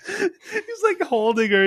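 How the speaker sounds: high, squeaky laughing voice; speaking through laughter. Real, not acted. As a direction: squeeze the words out while cracking up. Cracking up while retelling scene.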